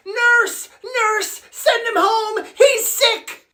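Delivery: high-pitched alarmed calls; panicked calling. Acted. Nerd calls nurse about sick classmate, panicked. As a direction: call urgently for help.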